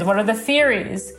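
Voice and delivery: ordinary talk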